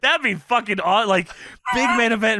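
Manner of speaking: usual speaking voice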